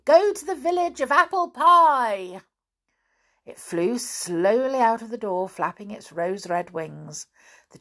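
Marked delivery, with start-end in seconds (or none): 0.0-2.4 high, loud magic command; loud cry. A narrator's reading. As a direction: cry it out to send the chair flying. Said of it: "cried out loudly".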